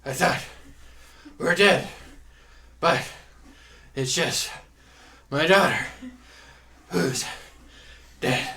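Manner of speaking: put-on loud, high, panting old sailor; out of breath old man. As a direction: gasp it out, winded and loud. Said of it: "I'm out of breath from doing that".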